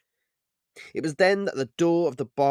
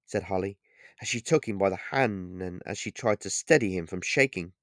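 plain reading voice

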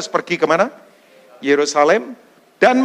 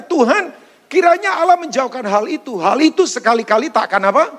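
higher, louder, protesting disciple; Peter rebuking Jesus; 7 semitones higher, 3 dB louder than usual. Acted. Peter pulling Jesus aside to rebuke him, raised protesting voice. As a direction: push back at the teacher, pitched up. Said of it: "dia berkata, katanya".

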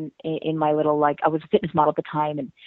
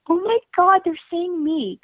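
giddy disbelief; 12 semitones higher, slower than usual. Retold or imitated. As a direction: squeal like a starstruck young girl. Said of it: "basically what I'm saying is".

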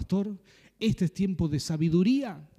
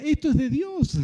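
mock-thrilled teen; 8 semitones higher, 4 dB louder than usual. Teen convinced the bike is fate.

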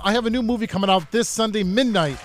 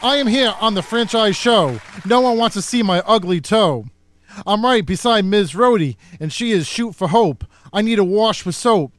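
a touch louder rhyming recitation; poem recital; 2 semitones higher, 5 dB louder than usual. Acted. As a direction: perform the comic verse with a beat. Recites his comedy poem.

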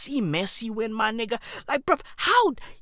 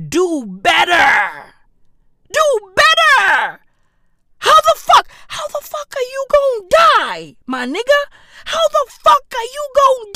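high, loud outraged yell; shouted comic tirade; ranting shout; 10 semitones higher, 6 dB louder than usual. Acted. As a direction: scream the scolding at her. Rant peaking at Queen.

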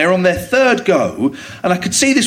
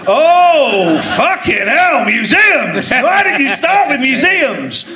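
mock outrage; 6 semitones higher, much slower than usual. Guest complaining to host about his question, exasperated. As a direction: draw out a hammed-up, outraged complaint.

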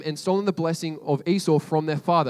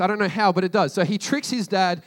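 ordinary talk, nothing marked

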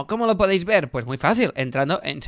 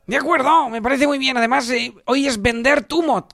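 Bender; 9 semitones higher than usual. Acted. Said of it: "que es mi nombre, Bender".

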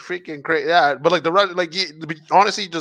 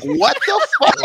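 much higher, rising what-the-fuck; shocked squeal; 5 dB louder than usual. Retold or imitated. Watching a father fire a gun, squealing in shock. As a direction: shoot up into a squeal of disbelief. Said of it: "I'm like".

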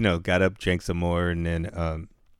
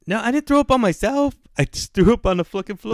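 much higher, louder protest; indignant protest; 6 dB louder than usual. Real, not acted. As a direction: pitch jumps way up in loud self-defense. Protesting the friend's shower question.

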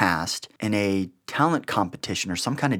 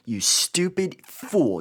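slow, drawn-out, much higher mock scold; mock the foolish husband, stretching each word; scolding wife; 7 semitones higher, much slower than usual. Wife scolding her grinning husband, slow high mock disdain.

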